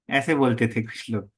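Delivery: normal talking voice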